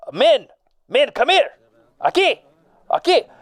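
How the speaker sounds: calling out to the men. Acted. Engineer calls his digging crew over, loud and high. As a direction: summon the workers from across the site.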